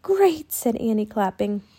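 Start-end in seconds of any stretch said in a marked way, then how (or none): 0.0-0.5 put-on high, pleased girl's exclamation; delighted cheer. A narrator's reading. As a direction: cheer on a small triumph. Annie claps as bird flies.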